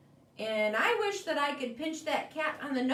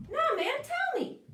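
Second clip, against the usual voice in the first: eager bird; 13 semitones higher than usual. Acted. Bird urges crab.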